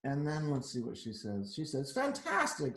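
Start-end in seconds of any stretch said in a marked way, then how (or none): 1.9-2.8 high, gushing fantastic; mock amazed. Princess praising the dragon, high gushing. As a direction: gush over him. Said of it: "said Elizabeth".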